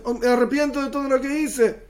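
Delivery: anguished lament. Acted. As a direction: cry out in dismay at the punishments. Sinner begs, repenting. (lamento angustiado, mucho más agudo)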